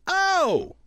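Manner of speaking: high soul shout "Oh!". Retold or imitated. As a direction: belt out a high "Oh!". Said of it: "[name]'s gonna go".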